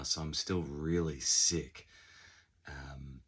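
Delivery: ordinary talk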